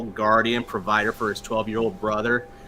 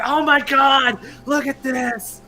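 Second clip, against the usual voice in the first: very high, loud fake panic; mock horror; 6 dB louder, slower than usual. Prankster showing off his pulled-off fingers, high mock-horrified cry. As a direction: shriek in pretend agony, pitch flung way up. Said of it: "I was like".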